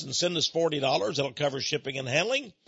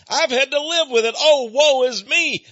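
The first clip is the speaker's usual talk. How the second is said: hammed-up woe, higher and louder; dramatic sigh; 9 semitones higher, 8 dB louder than usual. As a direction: groan it like a tragic martyr. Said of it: "No, no, no, not at all".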